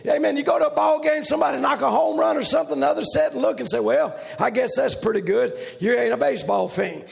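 shouted, fast preacher's delivery; preaching at full force. Acted. Preacher rousing the church, shouting preaching. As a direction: whip the congregation up.

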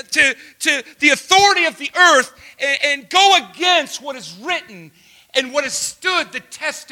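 shouted, very high and loud sermon climax; preaching at full force. Acted. Pastor shouting hope at the congregation. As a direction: build it to a roaring shout.

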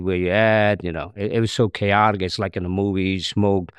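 ordinary talk, nothing marked

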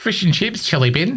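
high hammed-up New Zealand accent; Kiwi accent. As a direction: squeak it out like a Kiwi.

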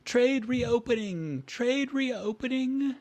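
high, slow town crier call; barker crying out. Acted. Barker announcing trade to the town, drawn-out cry. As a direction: draw it out for the whole street. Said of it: "heard a barker screaming out".

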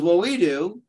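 lifted, proud boast; proudly. A narrator's reading. Moses boasting proudly to the angels. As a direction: say it with puffed-up pride. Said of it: "he proudly says".

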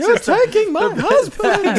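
high, slow, laughing lament; dramatic wail. Retold or imitated. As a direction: ham up the despair, slowly. Acting out the posh wail.

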